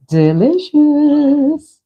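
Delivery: drawn-out, high singsong savouring; singsong relish. Pretend. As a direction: stretch it out high on a held note. Savouring the planned pumpkin meal.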